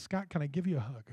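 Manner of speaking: softly, almost under breath, asking for a hug; gentle quiet ask. Retold or imitated. Preacher voicing the grieving mother asking the boy for a hug, soft and tender.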